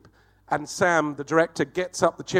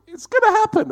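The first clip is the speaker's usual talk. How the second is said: very high, loud build-up; excited anticipation; 8 dB louder than usual. Retold or imitated. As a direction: squeal it as the big moment arrives. Retold thought at the climactic moment.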